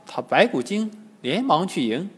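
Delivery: plain reading voice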